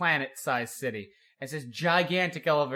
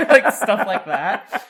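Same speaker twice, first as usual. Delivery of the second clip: high laugh spilling into the words; can barely talk for laughing; laughing hard; 6 semitones higher, 13 dB louder, much slower than usual. Real, not acted. Friend cracking up at bad captions, laughing.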